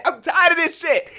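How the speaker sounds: high, loud fed-up exclamation; mock exasperated outburst. Imagined teacher venting at the class, mock-exasperated and loud. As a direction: blow up in jest, imitating. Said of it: "She didn't say that".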